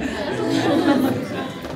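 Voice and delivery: a short choked laugh through crying; real teary chuckle; tearful laugh. Real, not acted. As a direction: let a laugh break out between tears. Laughs mid-tears after apologizing.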